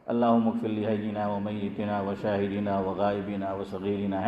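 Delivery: low, soft monotone recitation; recited Arabic prayer. Shown as a demonstration. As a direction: recite the prayer quietly on one low note.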